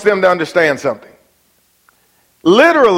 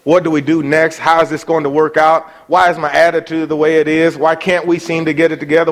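normal talking voice

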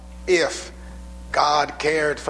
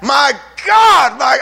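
a loud, pained shout, higher and louder; anguished cry; 8 semitones higher, 9 dB louder, slower than usual. Shown as a demonstration. Preacher showing David's anguished cry to the congregation. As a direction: cry out My God in agony. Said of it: "much more like that in its expression".

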